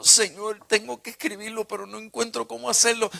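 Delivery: desperate pleading cry to the Lord; anguished plea. Retold or imitated. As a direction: beg God through tears. Pleading to God amid inner battle.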